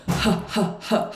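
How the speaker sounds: three separate breathy "ha" puffs; forceful belly huffs; huffed H sounds. Shown as a demonstration. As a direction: puff out "ha" from the belly. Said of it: "hands on their belly and go".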